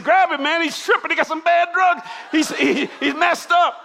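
high, loud panicked yell; 1 alarmed yell. Retold or imitated. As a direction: shout it like a bandmate grabbing a friend. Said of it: "my drummer's Jimmy said".

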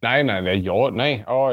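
ordinary delivery

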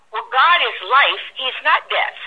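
ordinary talk

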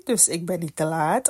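usual speaking voice

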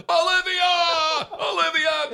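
high, long-drawn holler of a name; group yell. Retold or imitated. Whole group cheering for a daughter, imitated yell. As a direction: imitate a whole crowd yelling out. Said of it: "they'd all just yell".

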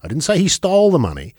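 "stole" pitched high, then a fall; stress on STOLE. Shown as a demonstration. Host shows how emphasis changes meaning, stress on "stole". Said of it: "where you put the emphasis in that sentence".

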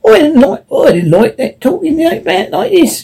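jay refusing the grim talk; high jay voice. Acted. High jay voice, upset.

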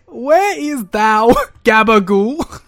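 loud, high, slow mock-archaic call; hammy olde-worlde New Yorker; mystical D&D character. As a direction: declaim it grandly, like bad fantasy role-play. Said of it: "whatever mystical character".

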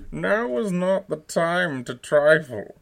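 loud, slow, muffled villain threat; menacing, spoken into a cup; Bane voice. Shown as a demonstration. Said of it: "Bane has never sounded so good".

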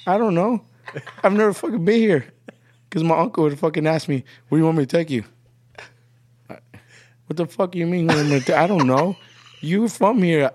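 high-pitched clueless protest; mock exasperation. Visitor telling uncle he doesn't know the area, mock exasperation. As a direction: plead total ignorance, comically put out.

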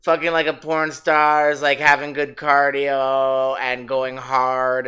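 ordinary talk

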